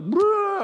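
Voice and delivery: loud, high burst of mock bawling; high blubbering outburst, hammed up; mock bawling wail. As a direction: imitate yourself bursting into a wail. Imitates his own bawling on the phone.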